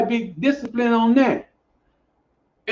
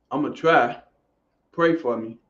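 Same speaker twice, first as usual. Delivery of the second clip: much lower, softer whiny mock; weak excuse voice; 11 semitones lower, 4 dB quieter than usual. Coach mocking how clients talk, limp and low. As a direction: imitate a half-hearted excuse maker. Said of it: "We talk like".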